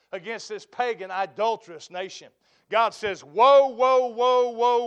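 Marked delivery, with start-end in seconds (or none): 3.2-4.8 loud, ringing woe, woe; booming woes. Acted. Preacher voicing God's judgment, booming woes. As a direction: thunder each woe like a judgment.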